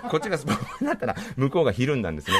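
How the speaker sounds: can't stop laughing while telling it. Real, not acted. Laughing as he admits he answered the door naked.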